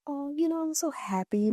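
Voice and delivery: high-pitched impression of a delighted mother; imitating happy mom. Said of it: "You're, like, happy about my partner calling".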